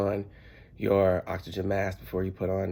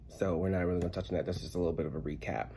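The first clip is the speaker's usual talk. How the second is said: usual speaking voice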